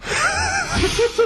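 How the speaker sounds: a squeaky giggle; quick high chuckle; high laugh. Real, not acted. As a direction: giggle at your own joke. [name] laughs at his own clue.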